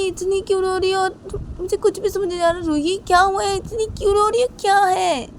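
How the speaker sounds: worried, puzzled mother. Acted. Mother to her crying daughter, anxious and puzzled. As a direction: press her daughter with confused questions.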